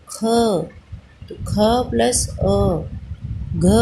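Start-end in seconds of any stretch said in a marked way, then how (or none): 1.3-3.7 each sound drawn out slowly; slow spelled-out sounds. Shown as a demonstration. Teacher to children, slowly sounding out क plus अ. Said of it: "sound आ रही है ना अ की".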